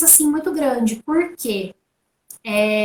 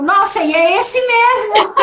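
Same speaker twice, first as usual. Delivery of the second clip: mock surprised husband; 7 semitones higher, 4 dB louder than usual. Marido surpreso, citado.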